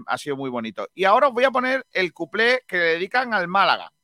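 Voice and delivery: ordinary talk, nothing marked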